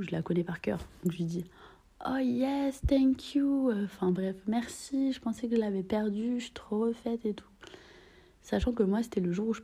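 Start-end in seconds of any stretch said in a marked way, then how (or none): 2.1-7.5 high, sugary fake-surprised thank-you; sweetly feigned surprise, pitched up; faux-innocent surprise. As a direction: play dumb and sweet, pitched up.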